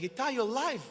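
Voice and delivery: high, loud, overjoyed cry; a friend's joyful shout on hearing he survived; joyful disbelief. Retold or imitated. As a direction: burst out with joy that he lived. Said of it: "I hear the joy in his laugh".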